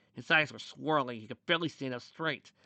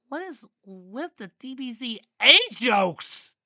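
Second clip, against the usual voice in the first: very high, loud, slow complaint; exasperated outcry; 12 semitones higher, 12 dB louder, slower than usual. A narrator's reading. Character fed up with the DBZA jokes, exasperated outcry. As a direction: wail it in exasperation, stretched out.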